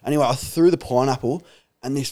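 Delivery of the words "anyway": normal talking voice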